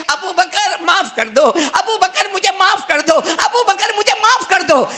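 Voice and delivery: high loud pleading cries, near shouting; crying out. Acted. Umar comes crying out to Abu Bakr, high and loud pleading. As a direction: call out desperately for forgiveness. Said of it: "چلاتے چیختے ہوئے آئے".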